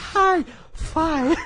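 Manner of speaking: very high, loud mock squeak; squeaky voice. Mocking the annoying voice on the podcast, squeaky. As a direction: pitch it way up into a silly squeak. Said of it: "my annoying squeaky voice".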